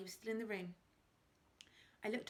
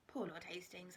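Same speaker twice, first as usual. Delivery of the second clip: ordinary delivery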